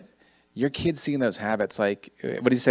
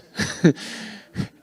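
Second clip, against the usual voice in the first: laugh. Real, not acted. Laugh at own story.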